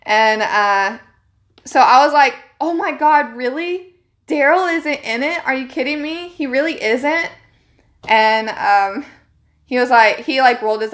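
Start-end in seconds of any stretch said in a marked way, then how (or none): 2.6-7.3 mock surprise, pitched up and gushing; hammed-up disbelief to troll the clerk; fake shocked fan. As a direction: play dumb, overdo the shock. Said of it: "I'm gonna troll".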